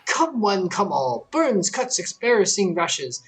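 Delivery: a merchant calling out to a crowd of travelers; hawker's sales pitch. Acted. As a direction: sell the mystic waters to passersby. Voices a hot-spring hawker NPC.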